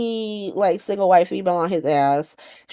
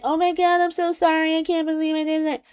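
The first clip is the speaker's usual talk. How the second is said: high, whiny put-on tearful apology; Brooklyn's mock crying; 8 semitones higher than usual. Brooklyn crying to Chase about it, mock-tearful high whine. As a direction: imitate a weepy apology, pitched way up. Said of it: "she out here crying in her cornflakes".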